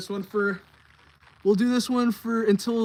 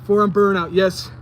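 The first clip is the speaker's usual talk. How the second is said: excited announcement; 10 dB louder than usual. Real, not acted. Announcing the exercise again.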